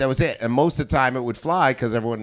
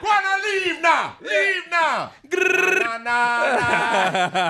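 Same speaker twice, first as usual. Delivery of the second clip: much higher shouted Jamaican patois; shouting Jamaican patois character; 13 semitones higher, slower than usual. Acted. Comic acts his character yelling at heckler, shouted Jamaican patois. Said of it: "stayed in character".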